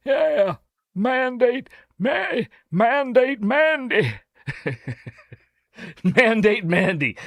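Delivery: high, slow, drawn-out Southern politician voice; Bill Clinton impression. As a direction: stretch each word like a folksy ex-president. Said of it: "with my Bill Clinton".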